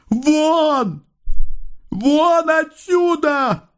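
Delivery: very high, drawn-out yell; frenzied shout. A narrator's reading. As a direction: scream a furious order to get out. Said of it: "не вязалась с криком, воплем, бешеным приказом". (бешеный крик, вопль)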